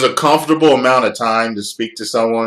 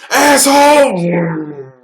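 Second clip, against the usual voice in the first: mock furious yell; 6 dB louder than usual. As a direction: scream insults at a driver. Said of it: "People will call you buddy".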